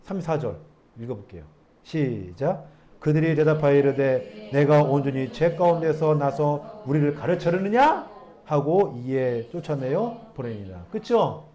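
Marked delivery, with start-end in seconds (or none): 3.0-10.8 slow, measured Bible reading voice; scripture reading. Acted. Pastor reading the verses to the congregation, measured and formal. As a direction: read the verses out to the church.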